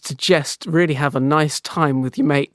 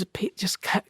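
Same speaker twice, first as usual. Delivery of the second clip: hushed whisper; 5 semitones higher, 9 dB quieter than usual. Acted. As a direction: whisper it, alarmed. Friend hushed about uncle.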